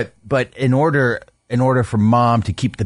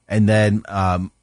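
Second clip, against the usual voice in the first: usual speaking voice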